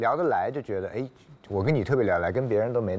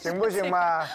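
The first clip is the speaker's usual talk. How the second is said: cutesy whiny pleading; 8 semitones higher than usual. Pretend. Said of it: "撒娇八连拍".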